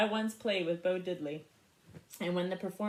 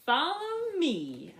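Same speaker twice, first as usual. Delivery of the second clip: confident; 7 semitones higher, 4 dB louder, much slower than usual. Acted. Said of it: "I said".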